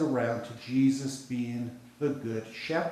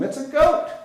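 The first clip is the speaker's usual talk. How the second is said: much higher and louder, hit the word goat; excited punchline; 14 semitones higher, 9 dB louder than usual. Acted. Pastor drives home that a goat leads sheep, excited burst. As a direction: land the reveal with a sudden high burst.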